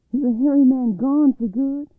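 put-on high, loud, excited child character; excited storybook boy calling out; eager boy's question. A narrator's reading. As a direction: ask it loudly, bursting to know. Boy asks after the danger.

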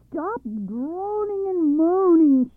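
high, slow, loud shout over the din. Acted. Father shouting over the wind's din. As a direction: yell above the roaring wind. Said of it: "shouted Muggle".

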